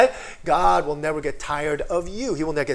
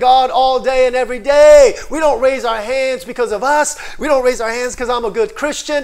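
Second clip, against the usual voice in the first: loud, high, driving preacher voice; full-force preaching; 7 semitones higher, 11 dB louder than usual. Acted. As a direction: preach it out to the congregation.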